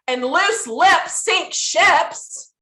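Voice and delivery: loose lips sink ships, each word drawn out slowly; slow saying. Acted. Speaker teasing gossiping friends, slow punchy proverb. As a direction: land the proverb deliberately, slowly.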